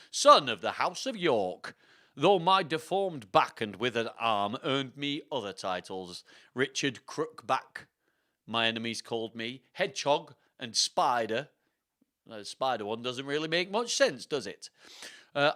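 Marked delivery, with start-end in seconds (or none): 0.1-11.5 slow, theatrical put-on accent; Richard the third. Richard listing the titles his enemies gave him, slow stagey accent. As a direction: declaim the nicknames in a put-on accent. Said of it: "fun to say in this accent".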